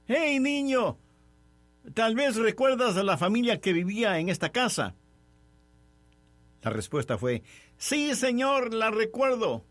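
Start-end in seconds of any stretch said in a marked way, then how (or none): 0.1-4.9 put-on higher-pitched call to a child. A narrator's reading. Father hails a boy on a bicycle, calling out. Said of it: "en voz alta le preguntó". 7.8-9.6 put-on high-pitched child's reply; boy's voice. A narrator's reading. Boy on a bicycle answers a stranger, high boy's voice.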